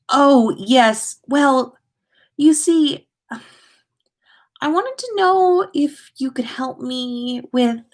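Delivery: nervous. Acted. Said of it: "he said nervously".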